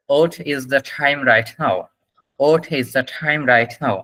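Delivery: slow model question, "What" as "ot"; slowly, "What" without its w, like "ot". Shown as a demonstration. Teacher modelling the time question, slow British-style "What". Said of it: "আপনি উচ্চারণটা করবেন".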